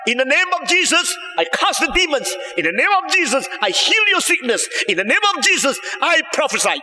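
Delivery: high, declaiming preacher imitation; mock a showy healer's repeated proclamations; imitating boastful miracle workers. Imitating false servants boasting of miracles to Jesus, high and declamatory. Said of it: "Mereka, Demi nama Yesus".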